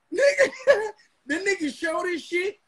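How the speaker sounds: high-pitched, laughing disbelief. Real, not acted. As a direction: crack up while telling it. Laughing at rival's fake diamonds.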